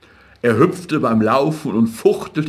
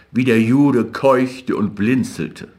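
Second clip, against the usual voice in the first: ordinary delivery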